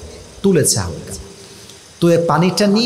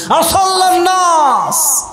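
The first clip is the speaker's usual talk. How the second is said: very high, drawn-out cry; acted outcry; 11 semitones higher, much slower than usual. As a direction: cry out the question slowly at full pitch. Dying caliph's first words, acted. (টানা উঁচু গলায় আর্তজিজ্ঞাসা)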